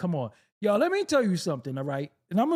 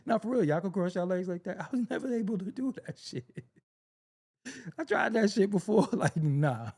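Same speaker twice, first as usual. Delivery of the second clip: ordinary talk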